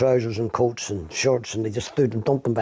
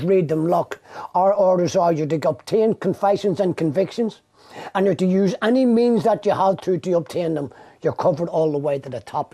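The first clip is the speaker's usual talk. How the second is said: menacing detective; 6 semitones higher than usual. Retold or imitated. Retelling a detective's threat.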